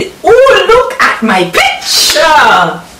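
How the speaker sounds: high squeal of delight, stretched slow; real thrill at seeing herself; excited squeal. Real, not acted. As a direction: gush over the photo. First peek at magazine feature.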